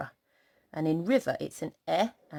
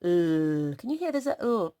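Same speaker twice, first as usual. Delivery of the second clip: ordinary talk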